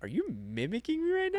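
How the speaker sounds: high, incredulous question; surprised challenge. Retold or imitated. Customer calling out the cashier, surprised. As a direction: catch her copying you, amused.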